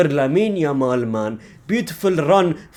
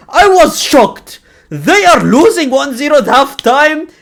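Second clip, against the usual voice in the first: loud shock; 10 semitones higher, 7 dB louder than usual. Real, not acted. Fan recalling halftime score, loud disbelief. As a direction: exclaim it in disbelief.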